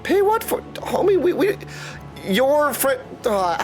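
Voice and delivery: ordinary talk, nothing marked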